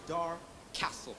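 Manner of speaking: ordinary talk, nothing marked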